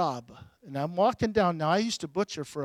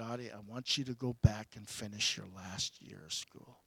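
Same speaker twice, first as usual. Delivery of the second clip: hushed, gentle divine voice, lower; soft voice of God; 7 semitones lower, 11 dB quieter than usual. Retold or imitated. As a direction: speak as God, softly and low. God's words quoted in sermon.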